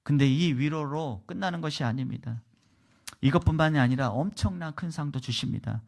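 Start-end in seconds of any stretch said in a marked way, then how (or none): none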